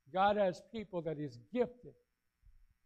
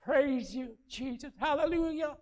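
할렐루야 부르는 할머니 흉내; imitating praising widow; 6 semitones higher than usual. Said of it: "she'd start to say".

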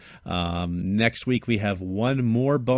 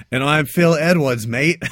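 loud, slow bad Australian accent doing Phil; hammed-up Australian, drawn out and loud; 5 semitones higher, 7 dB louder, slower than usual. [name] doing Phil's voice in a bad Australian accent. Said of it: "well, I'm Australian, am I?".

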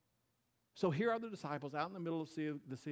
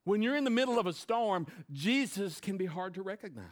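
repeated higher and louder; 6 semitones higher, 7 dB louder than usual. Shown as a demonstration. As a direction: proclaim it, high and strong. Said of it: "can I just say something".

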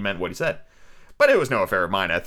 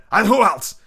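higher and louder question; demanding shout; 9 semitones higher, 6 dB louder, slower than usual. Acted. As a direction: bark it at him. Captain demands.

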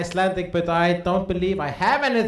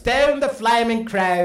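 put-on Australian accent; 7 semitones higher, 5 dB louder than usual. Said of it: "I can't do an Australian accent".

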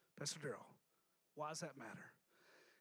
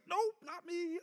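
mock denial; 8 semitones higher, slower than usual. Mock congregant denying it.

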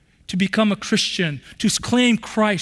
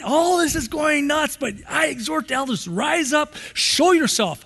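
rousing exhortation; 7 semitones higher than usual. Acted. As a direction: call the elders to stand and fight. Peter exhorting elders, acted.

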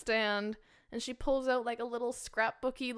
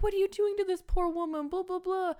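high-pitched mock indignation; imitated scolding; 10 semitones higher than usual. Parents break in on the boy, mock scolding. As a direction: imitate relatives scolding, much higher. Retold family bursting in, scolding.

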